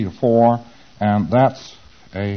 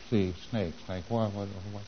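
quiet, trailing mumble to oneself; mumbling preclear; 2 semitones lower, 12 dB quieter than usual. Acted. Client gropes for an answer, in a soft mumble. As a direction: mimic a client searching memory, very softly. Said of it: "P C says".

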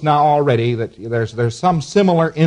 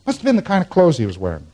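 quick, higher, as the answer lands; sudden realisation; 6 semitones higher, faster than usual. Acted. Lecturer acting out a patient finding the answer, suddenly sure. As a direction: arrive at it briskly after the searching.

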